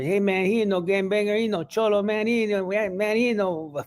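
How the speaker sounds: security guard voice. Retold or imitated. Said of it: "all of a sudden I hear".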